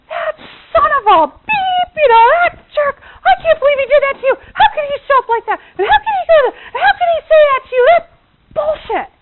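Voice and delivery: high, loud, racing mock tirade; hammed-up outraged yelling; venting rant. Wife discharging anger on husband's behalf, hammed-up furious rant. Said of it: "discharge about it for you".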